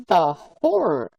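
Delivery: milk it like a melodrama; mock horror. Streamer mocking a viewer's capture button, hammed-up horror.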